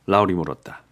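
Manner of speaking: ordinary delivery, nothing marked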